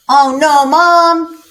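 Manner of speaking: put-on high, whiny-kid style protest; protesting child. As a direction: object like a kid pulled away from play. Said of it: "I go over to Jason".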